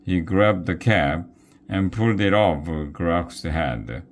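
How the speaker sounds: ordinary delivery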